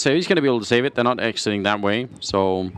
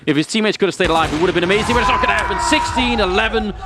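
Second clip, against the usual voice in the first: building from fast and eager to a much higher, louder shout; rising excitement; 9 semitones higher, 4 dB louder, faster than usual. Real, not acted. Caster calling the deciding round, building into an excited shout. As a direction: ramp up fast into a full-throated win call.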